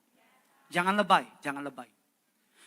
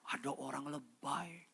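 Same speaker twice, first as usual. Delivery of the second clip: whisper; 10 dB quieter, slower than usual. Real, not acted. Aside about overreacting people.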